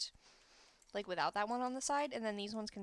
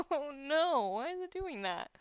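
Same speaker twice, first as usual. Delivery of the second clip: rising, louder cry of dismay at a glitch; 6 semitones higher, 6 dB louder than usual. Real, not acted. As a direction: react to the glitch with real alarm. Game glitch annoys the player.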